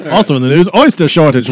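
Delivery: loud news-bulletin announcement; mock newsreader. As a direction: break in loudly with a spoof headline.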